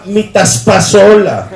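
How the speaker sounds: mock menacing order; slow mock threat. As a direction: drag it out deadpan.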